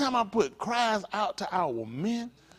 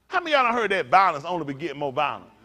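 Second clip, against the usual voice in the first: ordinary delivery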